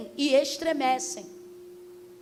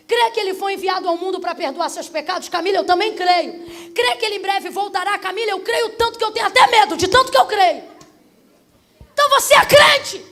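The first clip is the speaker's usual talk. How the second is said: building from raised voice to high shout; full-force preaching; 4 semitones higher, 9 dB louder than usual. Real, not acted. Preacher acting out a believer's eager answers, full-force preaching. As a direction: escalate each answer to a shouted climax.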